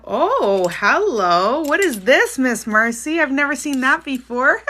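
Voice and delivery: loud, swooping exclamations rising high; delighted surprise. Real, not acted. Streamer to viewer Miss Marcy over something new, loud delighted surprise. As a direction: exclaim big and loud, pitch leaping in amazement.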